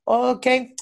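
ordinary delivery

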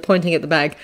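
plain reading voice

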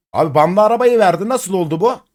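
blurting out in stunned disbelief; shocked outburst. Real, not acted. Streamer shocked the game gave away the banned car, loud disbelief.

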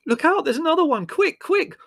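very high, loud squeal of alarm; panicked warning. Acted. Margot panicking again.

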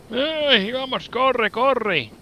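high urgent yelling; panicked run, urging himself on; being chased. Real, not acted. Running from enemies.

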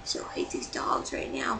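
hushed, breathy whisper of a grudge; a retold whisper of annoyance; whispered quote. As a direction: whisper it as if the dogs might hear. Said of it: "I was just like".

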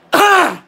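one real, loud, high cough; sudden cough cutting off speech. Real, not acted. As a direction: cough hard mid-sentence. Coughing fit on stream.

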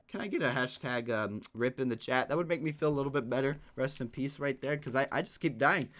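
low, soft, defeated voice; deflated. Real, not acted. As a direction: sink into a quiet, beaten plea. Asks sympathy after dying again.

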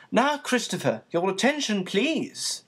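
brisk teacher's command; crisp and authoritative. A narrator's reading. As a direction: call the pupil to attention, crisp. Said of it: "his tone was crisp and authoritative".

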